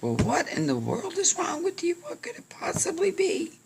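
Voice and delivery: high, strained squeak of disbelief; acted exasperation. Carrier exasperated with frightened friend.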